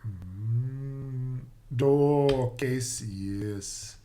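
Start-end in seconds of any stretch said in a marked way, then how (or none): none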